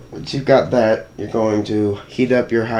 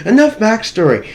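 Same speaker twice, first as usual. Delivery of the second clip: excited; 10 semitones higher, 5 dB louder than usual. Acted. Abrupt switch back to work.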